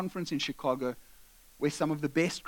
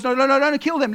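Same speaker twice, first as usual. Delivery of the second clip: loud, raised pleading no; older brother stops them loudly; urgent protest; 7 semitones higher, 8 dB louder than usual. Retold or imitated. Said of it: "Reuben goes".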